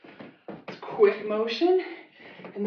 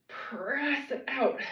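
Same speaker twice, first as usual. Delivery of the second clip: slow drawn-out cue; 5 semitones higher, much slower than usual. Shown as a demonstration. Coach cueing a slow press, stretched out. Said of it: "Pause when you get out there".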